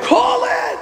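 put-on high, eager call to the kid; hyped call. Man flipping for the boy, high excited call. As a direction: build the moment up.

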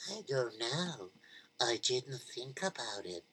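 soft-spoken mother. Acted. Malkele answering her neighbour, low and soft. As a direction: mumble it low, unsure.

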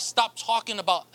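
ordinary delivery

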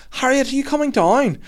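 high mock call up a slide. Mum shouting up the slide.

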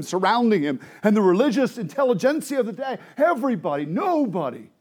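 high-pitched, driving sermon delivery; impassioned preaching. Real, not acted. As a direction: press the point on the congregation. Preacher builds his point.

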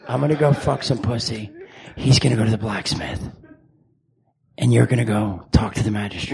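low brooding-hero voice; put-on low vigilante voice; Dark Knight. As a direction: hand out orders deep and low. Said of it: "sound like fucking the Dark Knight".

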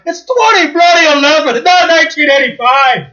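loud, high Scottish shout; Scottish accent. Retold or imitated. Scottish chef yelling at the pizza owner, loud Scottish shout. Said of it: "they're actually Scottish now".

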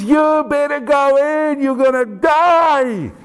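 slow, high-pitched acted yell; drawn-out desperate warning cry, acted; shouting into a hole. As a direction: holler each word long, as if down a hole. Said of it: "he shouted into that hole".

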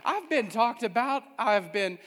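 loud, rising preacher's cry; full force preaching. Acted. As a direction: preach it out to the congregation.

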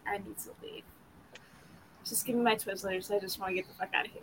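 whispered thought. Retold or imitated. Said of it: "I'm just like".

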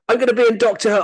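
high-pitched inner wail; hammed-up actor's disappointment; mock dismay. Acts his thought on reading script.

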